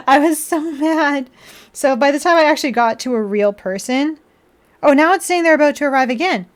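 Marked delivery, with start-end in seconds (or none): 0.1-1.3 slow, high, stressed repeat; emphatic. Real, not acted. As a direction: lean hard into mad.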